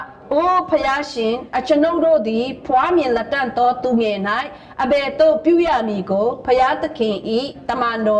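measured, solemn Bible reading; scripture reading. Acted. Reads scripture aloud in sermon.

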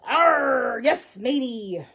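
slow, growled pirate drawl; big pirate voice. Acted. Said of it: "The big voice said".

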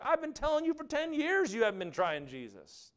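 high-pitched exasperated retort; mock exasperation. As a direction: pitch it way up as you set her straight. Exasperated retort to the young woman.

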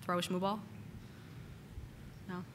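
ordinary talk

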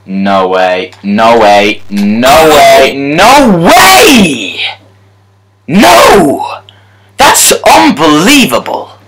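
rising outcry of shock; from muttered doubt to shouted no; building disbelief. Real, not acted. Late goal conceded.

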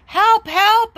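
high, loud cry of help; panicked cry for help. Acted. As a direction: call out in alarm, voice jumping up. Boy calls policeman in panic.

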